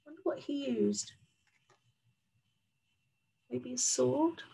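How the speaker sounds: plain reading voice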